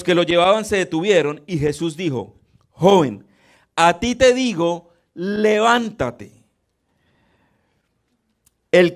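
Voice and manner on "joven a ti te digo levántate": loud, commanding call, slowing right down on levántate; Jesus commanding the dead youth to rise, loud and drawn out. Acted. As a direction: call the dead boy up with authority.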